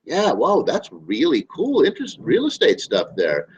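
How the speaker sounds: high, amazed voice, slowing at the end; mock amazed lead. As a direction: gape at it like it's the coolest thing. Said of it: "You're like".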